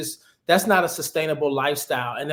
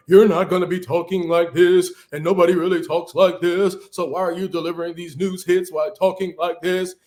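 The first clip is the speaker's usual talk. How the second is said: louder, stiff news-anchor delivery; broadcaster voice; 4 dB louder than usual. Retold or imitated. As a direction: put on a mock TV newscaster voice to show how fake it sounds. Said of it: "lose that broadcaster voice".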